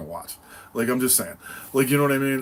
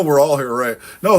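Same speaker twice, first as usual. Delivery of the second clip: ordinary delivery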